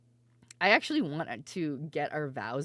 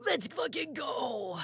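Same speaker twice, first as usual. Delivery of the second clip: slow drawn-out mock cheer, higher; hyped cheer; 8 semitones higher, 3 dB quieter, slower than usual. Streamer imitating herself cheering friends on, drawn-out mock cheer. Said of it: "I can't be like".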